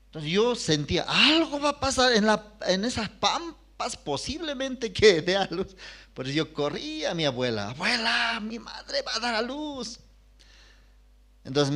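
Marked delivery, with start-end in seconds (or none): 1.1-5.8 put-on higher, worried little boy thinking aloud; a boy sensing trouble coming; young son fearing for his mother, voiced higher and worried; anxious child's thought. 7.7-10.0 put-on higher child voice telling grandma the news; a little boy running in with news; boy runs to grandmother, high and urgent; urgent child's plea.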